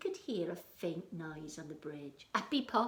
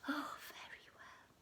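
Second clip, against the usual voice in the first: whispered troll; 7 semitones higher, 9 dB quieter than usual. Acted. Troll gives up.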